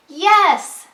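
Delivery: high, loud excited call; Jen yelling. Acted. Teacher voicing Jen's line for a child, yelling. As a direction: yell it like Jen at the picnic. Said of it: "yells Jen".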